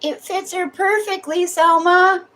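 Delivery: very high cooing voice. Real, not acted. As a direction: coo at the dog, very high. Praising the dog.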